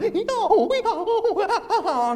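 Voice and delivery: high braying "No, no" like a mule; mule language. Retold or imitated. Mule-headed wife braying instead of words. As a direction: bray it out like a mule. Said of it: "speaking mule language like that".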